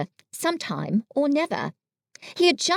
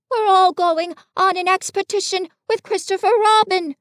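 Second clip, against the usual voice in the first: high, louder storybook bear voice; Pooh, eager messenger; 9 semitones higher, 5 dB louder than usual. Acted. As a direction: announce it eagerly in a high character voice.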